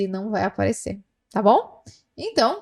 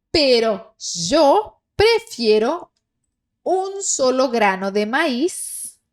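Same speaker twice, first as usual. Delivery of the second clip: slow dictation; slower than usual. Shown as a demonstration. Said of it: "slow reading for repetition".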